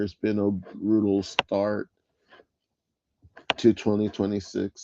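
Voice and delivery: usual speaking voice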